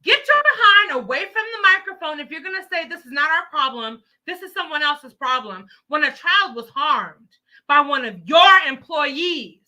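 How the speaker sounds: high, loud, fast furious tirade; angry shout. Real, not acted. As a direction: tear into them, loud and fast. Scolding officials on live stream.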